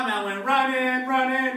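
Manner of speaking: ordinary talk, nothing marked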